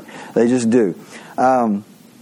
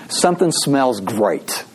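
announcing the sermon title; slower than usual. Shown as a demonstration. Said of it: "My sermon title is".

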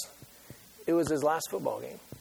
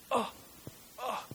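ordinary talk

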